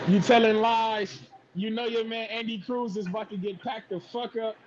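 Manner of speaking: distant, off-mic voice; far from mic. Real, not acted. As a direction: sounds far from the microphone. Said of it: "You sound like you far away".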